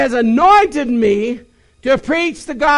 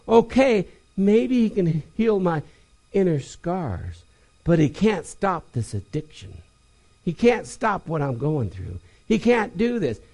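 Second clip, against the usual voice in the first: doubting believer; 8 semitones lower, 7 dB quieter than usual. Retold or imitated.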